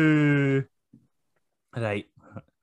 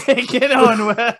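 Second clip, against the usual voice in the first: high, loud, giggly echo; laughing disbelief; 8 semitones higher, 7 dB louder than usual. Real, not acted. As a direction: repeat it through laughter, higher and louder. Incredulous laughing echo of odd phrase.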